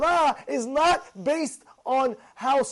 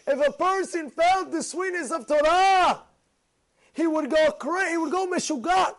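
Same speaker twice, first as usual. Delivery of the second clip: preaching fervor; 6 semitones higher than usual. Acted. Preaching excitement.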